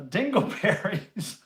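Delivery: mock shout. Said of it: "phrase I have to shout".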